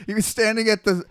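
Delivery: ordinary talk, nothing marked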